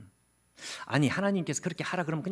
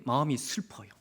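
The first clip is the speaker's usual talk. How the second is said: normal talking voice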